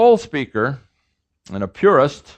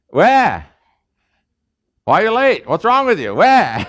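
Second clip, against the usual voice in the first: loud high scolding shout of Wah; angry boss; 8 semitones higher, 5 dB louder than usual. Retold or imitated. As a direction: bark at a latecomer who walked in late.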